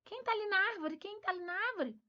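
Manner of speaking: put-on high, lively call to a child; mother showing her child a bird in the tree, high and excited; excited pointing out. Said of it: "quando a gente for lá fora".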